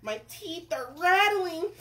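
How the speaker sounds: sustained high cartoon worry; scared cook. Cook dreads spitting oil, hammed-up fright. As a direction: fret loudly with hammed-up fear.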